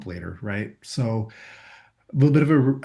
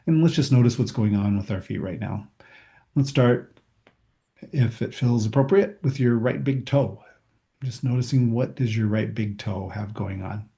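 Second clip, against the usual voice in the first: walk them through their toes, unhurried; calm body scan meditation voice; guided body scan. Acted.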